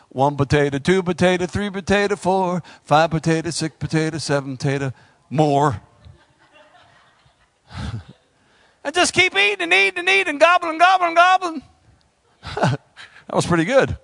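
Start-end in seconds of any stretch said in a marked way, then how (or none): 0.1-5.8 low, lilting counting chant; mock potato counting. 8.9-11.6 fast, high, rushing eating list; fast mock gobbling. Mocking gluttonous eating.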